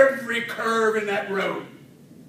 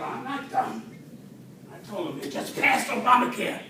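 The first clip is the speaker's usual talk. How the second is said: usual speaking voice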